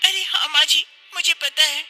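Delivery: put-on high-pitched young wife, breathless and relieved; young wife explaining. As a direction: tell her mother-in-law how you escaped. (ऊँची आवाज़ में बहू, राहत भरी)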